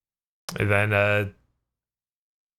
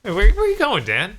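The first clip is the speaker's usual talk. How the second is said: calling out; 9 semitones higher, 4 dB louder than usual. Acted. Calling after walking-away friend.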